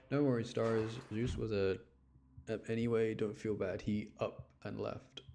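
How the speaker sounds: ordinary talk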